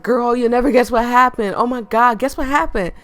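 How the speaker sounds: hammed-up "girl, listen" gossip voice; mock office gossip. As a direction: imitate a coworker bursting with gossip. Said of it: "I hate gossiping people at work. They'd be like".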